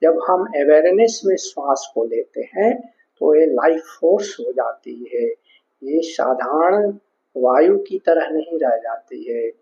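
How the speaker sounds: ordinary talk, nothing marked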